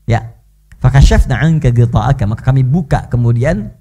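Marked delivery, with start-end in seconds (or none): 0.8-2.2 Quran recitation. Acted.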